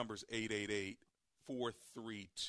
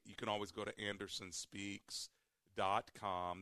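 normal talking voice

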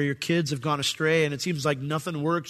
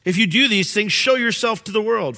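a touch of a sneering taunt, higher; mocking; 6 semitones higher, 5 dB louder than usual. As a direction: taunt him lightly. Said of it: "They're mocking him".

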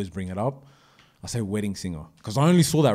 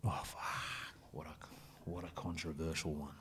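very soft, low murmur; hushed reaction; 7 semitones lower, 20 dB quieter than usual. Real, not acted. Host reacts to a controversial next question, hushed. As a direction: mutter it under your breath, almost whispered.

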